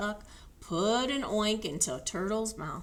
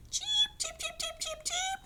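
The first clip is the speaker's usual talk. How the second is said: very high, tiny chirping; exclaiming cheeps. A narrator's reading. Chicks exclaiming cheeps on Horse's back. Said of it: "exclaimed the little chicks".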